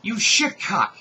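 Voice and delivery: high, loud furious insult; yelled insult. A narrator's reading. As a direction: spit the curse at him. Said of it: "yelled Donna Jo".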